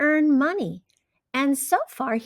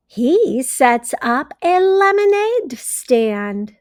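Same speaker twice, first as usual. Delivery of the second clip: bright, expressive storybook read-aloud; reading to children; 2 semitones higher, 5 dB louder, slower than usual. A narrator's reading. Teacher reading a story to her class, expressive read-aloud. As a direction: read it like a teacher sharing a picture book.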